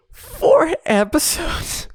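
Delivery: high, stretched-out disbelief; exasperated disbelief. Real, not acted. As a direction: drag out four episodes in outrage. Exasperated at the four-episode fight.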